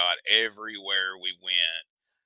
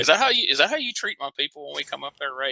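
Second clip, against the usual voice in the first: higher, fast mock outrage; put-upon, hammed-up protest; mock indignation; 7 semitones higher, faster than usual. Southerner to Northern co-host about visiting up there, mock indignant.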